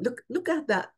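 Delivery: ordinary talk, nothing marked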